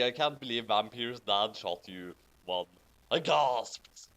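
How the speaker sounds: normal talking voice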